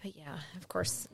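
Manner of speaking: whispered aside. Real, not acted. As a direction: whisper it like a confession. Aside about being partial.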